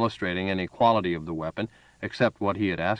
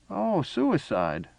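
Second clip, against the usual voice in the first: put-on much higher, slow old man's voice; old man's dawning understanding; 9 semitones higher, much slower than usual. A narrator's reading. Old man realizing Farah's purpose, high and slow. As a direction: draw it out as it dawns on you.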